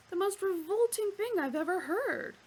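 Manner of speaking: high, loud disgusted cry; exclaimed. Acted. Arthur appalled by the animal, exclaiming. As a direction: recoil and exclaim.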